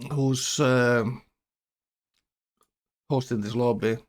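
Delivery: ordinary talk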